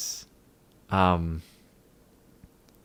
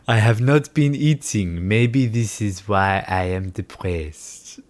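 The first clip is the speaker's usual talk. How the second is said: slow, mock-profound big brain voice; sarcastic genius, drawn out; slower than usual. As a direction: announce it slowly like a grand revelation. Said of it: "most giga brain conclusion".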